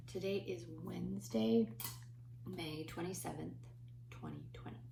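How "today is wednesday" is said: usual speaking voice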